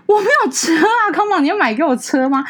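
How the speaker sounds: high, loud exasperated reply; indignant retort. Retold or imitated. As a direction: protest sarcastically, voice up. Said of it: "你为什么不开车上班". (高声不满地回嘴)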